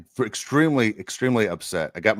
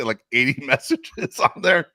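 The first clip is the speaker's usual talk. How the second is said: amazed; 4 semitones higher, 3 dB louder, slower than usual. Real, not acted. Amazed at message count.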